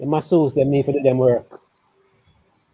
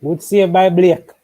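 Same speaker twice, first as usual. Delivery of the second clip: louder, higher and drawn-out cheer; excited call; 4 semitones higher, 5 dB louder, slower than usual. Real, not acted. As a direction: call the save like a goal. Live save, excited call.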